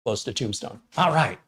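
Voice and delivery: normal talking voice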